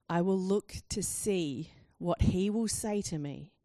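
slow, measured Bible reading; solemn, unhurried recitation; scripture reading. Acted. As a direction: read the verse deliberately, phrase by phrase. Let me read Habakkuk two, verse one.

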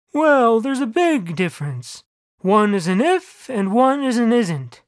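slow, lightly voiced deity; God. A narrator's reading. God explaining to the narrator, slow measured voice. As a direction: draw it out, explaining from on high.

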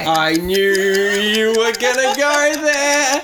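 loud drawn-out exclaim; loud outburst. Real, not acted. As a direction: shout it out in disbelief.

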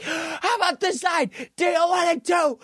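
high-pitched mock shout; Vince McMahon threat. Wrestling boss threatens the heckling crowd, high mock shout. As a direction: imitate an exasperated boss warning a crowd.

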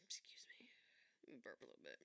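soft whispered aside; whispering. Real, not acted. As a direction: whisper it off-mic. Aside before burping.

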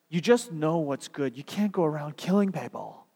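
usual speaking voice

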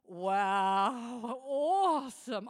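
loud, slow mock awe; sarcastic wife feigning delight at cricket news. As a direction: stretch out awesome with fake delight. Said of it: "I'm really sarcastic".

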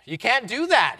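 loud, high protest; shouting. Acted. Bewildered passenger shouting at the pastor, loud. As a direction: shout it in disbelief. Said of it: "he shouted".

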